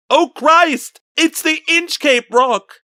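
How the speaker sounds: very high, loud cry of horror; terrified cry. Acted. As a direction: cry out in panic as the ship strikes. Sailors see ship strike rock.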